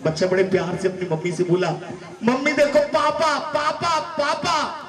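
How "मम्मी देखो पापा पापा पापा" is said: put-on high, thrilled little kid calling out; eager childish calling; excited child. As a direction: point and squeal at dad arriving. Child excitedly spotting dad.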